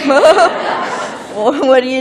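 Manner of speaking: a playful loud startled call; mock calling out. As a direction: call out to them, laughing. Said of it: "you would".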